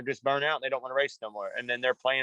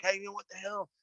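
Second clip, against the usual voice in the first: higher, softer mock reproach; mild retold scolding; 5 semitones higher, 7 dB quieter than usual. Coach getting on a young rider a little, mild scolding. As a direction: get on a kid lightly, no yelling. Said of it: "not even anywhere close to how my dad".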